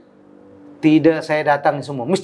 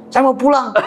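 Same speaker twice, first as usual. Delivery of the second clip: laughing whine; 9 semitones higher than usual. Retold or imitated. Laughing retold reply.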